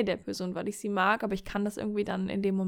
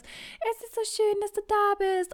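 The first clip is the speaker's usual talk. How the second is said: sweet lover voice; 12 semitones higher than usual. Pretend. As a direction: coo it like a dreamy lover. Imagined lover's sweet morning line. (viel höher, langsam und süßlich gesäuselt)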